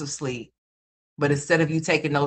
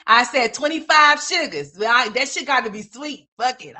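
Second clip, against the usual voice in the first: ordinary talk, nothing marked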